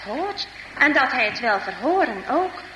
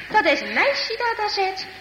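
surprised; 8 semitones higher than usual. Acted. Surprise at girl inside.

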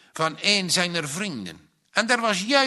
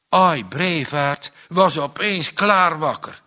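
usual speaking voice

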